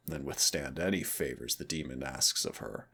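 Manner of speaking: normal talking voice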